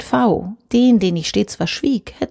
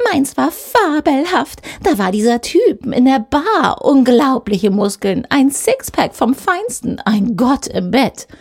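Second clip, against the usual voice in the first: slow, higher-pitched show-off voice; bragging colleague drawling her weekend, raised pitch; screeching; 5 semitones higher, slower than usual. Acted. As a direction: drag out every boast to impress. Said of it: "kreischte sie bereits".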